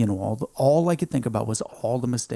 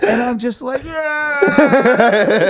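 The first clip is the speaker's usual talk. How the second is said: high breaking voice; 9 dB louder, much slower than usual. Real, not acted. Emotional peak of story.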